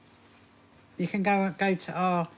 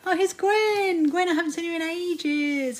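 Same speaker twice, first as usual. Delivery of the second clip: delighted greeting; 10 semitones higher, 4 dB louder than usual. Real, not acted. Greeting a viewer who just arrived.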